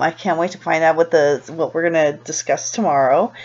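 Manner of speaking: ordinary delivery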